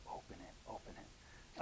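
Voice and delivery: breathy whisper, slow and eager; whispered urging. Retold or imitated. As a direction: whisper it like a secret you can't wait to share. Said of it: "It's the same kind of thing".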